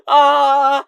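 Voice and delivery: high, loud acted sob; mock crying wail. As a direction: let out a hammed-up bawl. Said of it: "I started crying".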